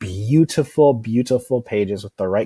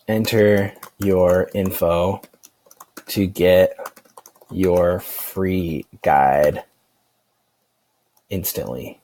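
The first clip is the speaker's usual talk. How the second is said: slow, word by word as if typing; fading as the last word is typed; slow dictation; much slower than usual. Shown as a demonstration. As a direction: spell out the subheadline slowly while typing. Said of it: "and I'm gonna say".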